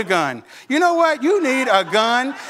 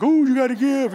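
Eugene puppet; 2 semitones higher than usual. Retold or imitated. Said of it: "the Eugene puppet was like".

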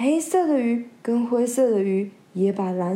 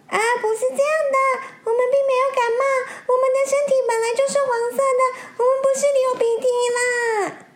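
much higher, loud and fast, near tears; panicked protest; 5 dB louder, much faster than usual. Acted. Yellow fish denying the cold, frantic tearful voice. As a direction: gabble the denial in panic.

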